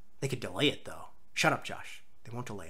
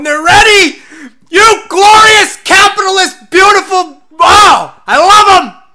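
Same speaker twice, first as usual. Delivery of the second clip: high, loud, gushing screams; ecstatic shouting; 11 semitones higher, 15 dB louder, slower than usual. Real, not acted. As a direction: scream your adoration to the skies. Ecstatic at Tom Nook's announcement.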